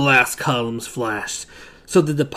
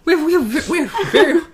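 laughing; 14 semitones higher, 5 dB louder than usual. Real, not acted. Mock military boast, laughing.

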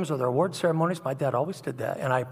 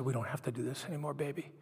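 much softer, quick and gentle reassurance; tender comfort; 10 dB quieter, faster than usual. Retold or imitated. Father kneeling to comfort his frightened daughter, softly. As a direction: hush her fears as you hold her.

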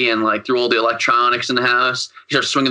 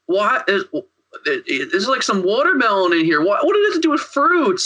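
high-pitched, incredulous imitation; mock bafflement; 9 semitones higher than usual. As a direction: voice climbs in puzzled disbelief, hammed-up. Retelling a baffled movie character's reaction.

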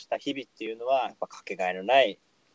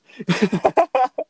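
sudden high-pitched laugh; high burst of laughter; 9 dB louder than usual. Real, not acted. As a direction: laugh out loud, high and bright. Laughing at drinking joke.

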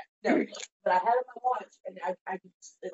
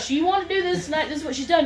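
high-pitched imitated self-defence; insistent, put-upon retold protest; exasperated; 8 semitones higher than usual. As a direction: act out a friend washing his hands of it. Friend defends himself on phone.

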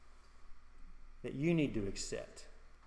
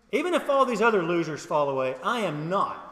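loud, raised-pitch boast; emphatic disagreement; 4 semitones higher, 7 dB louder than usual. Acted. Peter boasting over the other disciples, emphatic and loud. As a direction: declare it loudly over the others. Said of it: "all emphatically disagreed with Jesus".

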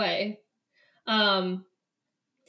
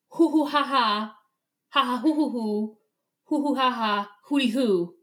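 playful imitated monkey calls, hoo hoo ha ha; monkey hoots. Describing the jungle to a friend, mock monkey calls. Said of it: "monkey sound".

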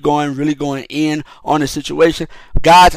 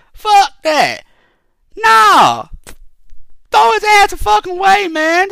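angry outburst; 12 semitones higher than usual. Real, not acted. Angry rant about trial system.

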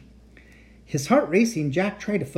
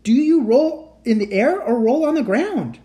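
high, loud yelled question; shouting; 8 semitones higher, 6 dB louder than usual. Acted. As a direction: shout it over the propellers. Shouting inside a loud plane.

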